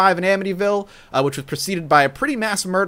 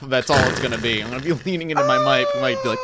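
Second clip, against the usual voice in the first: normal talking voice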